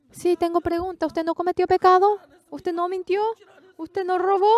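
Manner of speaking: doubting pastors. Retold or imitated. Pastors in the Dominican Republic question the preacher, high and incredulous. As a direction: challenge him like a skeptical pastor. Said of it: "los pastores".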